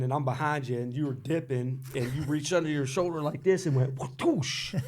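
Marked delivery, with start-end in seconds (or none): 4.0-4.8 imitated spit noise. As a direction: spit it out with a big whoosh. Spitting tobacco, imitated noise.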